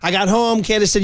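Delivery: ordinary talk, nothing marked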